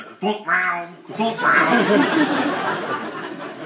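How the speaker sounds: high imitated thud and yowl; cat thumping and meowing. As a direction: act out a cat pounding the door. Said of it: "push his paws into the door".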